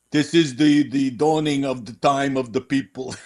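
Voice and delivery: lightly imitated Bronx accent; Tony Curtis. Podcaster recalling a funny Spartacus moment in a mock Bronx accent. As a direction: put on a mock old-movie Bronx accent. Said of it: "enunciating in his Bronx tone".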